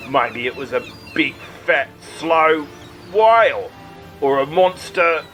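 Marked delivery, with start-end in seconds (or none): none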